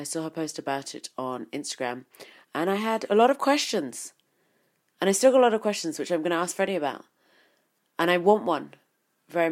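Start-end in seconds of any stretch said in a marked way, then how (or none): 2.5-9.5 higher and much louder, bursting with eagerness; excited. Real, not acted. Host hyping up the next guest's review with loud excitement. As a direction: gush about it like you can't wait.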